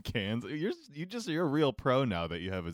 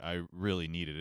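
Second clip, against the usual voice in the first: normal talking voice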